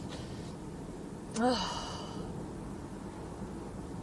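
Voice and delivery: long shaky exhale; long nervous sigh. Real, not acted. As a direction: let out a long nervous breath. Nervous sigh before braces.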